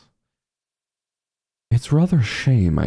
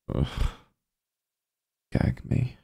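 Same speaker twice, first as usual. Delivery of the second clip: soft, higher mutter of disgust; disgusted aside; 4 semitones higher, 7 dB quieter than usual. Acted. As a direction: pull a face as if you'll be sick. Disgusted by her own letter.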